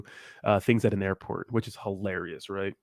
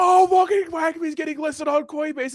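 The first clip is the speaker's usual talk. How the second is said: mock hyped crypto bro; slower than usual. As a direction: ham up an overexcited crypto fan. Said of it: "everybody's like".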